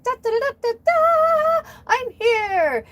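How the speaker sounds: very high, loud showy fanfare; brash Leo. Retold or imitated. Leos announcing themselves with a loud, very high fanfare. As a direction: burst in announcing yourself. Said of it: "And Leos are like".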